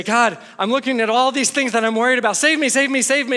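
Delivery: hammed-up panicked prayer; desperate plea. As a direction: cry out like a worrier in a panic. Praying in worried panic.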